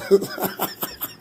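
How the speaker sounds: silent breathy laugh; helpless unvoiced laughter; wheezing laugh. Real, not acted. Host losing it at his own joke, wheezing.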